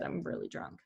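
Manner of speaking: whispered confession; whispered aside. Real, not acted. Aside about being drunk.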